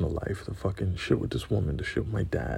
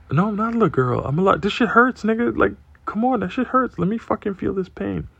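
much higher and louder, pleading outrage; heated protest; 12 semitones higher, 6 dB louder than usual. Retold or imitated. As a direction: argue back like a hurt kid, high and loud. Protesting back at his dad.

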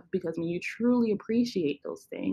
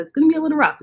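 excited and nervous; 10 semitones higher, 11 dB louder, much faster than usual. Real, not acted. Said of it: "I'm so excited and so nervous".

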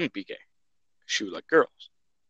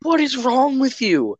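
shocked; 13 semitones higher, 4 dB louder than usual. Retold or imitated. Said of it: "I was like".

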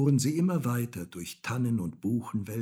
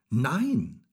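loud, raised cry of refusal; shouted refusal; 5 semitones higher, 5 dB louder than usual. A narrator's reading. Häwelmann refuses the moon's question, shouting. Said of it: "schrie Häwelmann".

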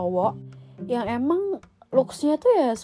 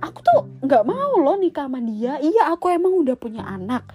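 defensive protest; 6 semitones higher, 4 dB louder than usual. Retold or imitated. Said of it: "dia sebenarnya kayak nggak terima".

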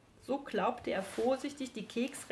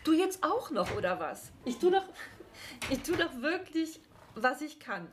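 exasperated; 7 semitones higher than usual. Acted. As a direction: cry out at the end of your tether. Exasperated gnome complains.

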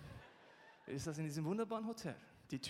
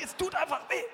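loud, high, hurting; pained insistence; 9 semitones higher, 8 dB louder than usual. Retold or imitated. Preacher insisting to the passenger, loud and pained. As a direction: insist loudly it just hurts. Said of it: "Ich sag".

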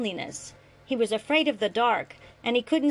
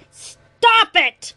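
full-throated scream, much higher and louder; 12 semitones higher, 13 dB louder than usual. A narrator's reading. Son screaming at father to stop, piercing scream. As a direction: scream it out to make him stop. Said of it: "Grant screamed".

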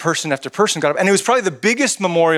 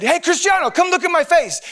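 accented mock yell; 13 semitones higher than usual. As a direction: yell it like a cheering fan, accent and all. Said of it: "I would yell at".

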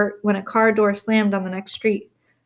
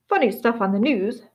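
ordinary delivery

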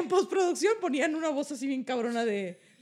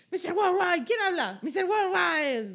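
[name] voice; 7 semitones higher, slower than usual. Retold or imitated. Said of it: "nomás di tres cosas".

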